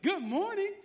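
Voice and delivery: high-pitched, over-cheerful greeting; hammed-up chirpy welcome; super happy. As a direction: sing it out with exaggerated happiness. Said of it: "I was just super happy".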